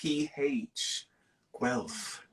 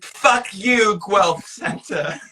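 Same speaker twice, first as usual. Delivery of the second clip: laughing; 8 semitones higher, 5 dB louder, slower than usual. Real, not acted. Laughing curse at Guelph.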